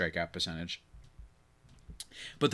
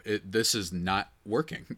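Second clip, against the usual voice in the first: normal talking voice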